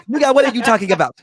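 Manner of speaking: loud, high, quick comic mimicry; high, fast mock outburst; funny Giannis impression. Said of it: "he said it so funny".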